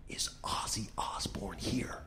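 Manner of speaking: hushed secretive whisper; whispering fan. Retold or imitated. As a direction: lean in and whisper the question.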